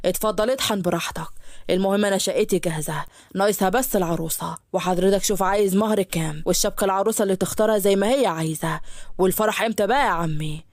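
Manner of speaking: low, cheeky rushed pitch; a groom talking the father round; eager suitor. Acted. Yusuf pitches himself to father.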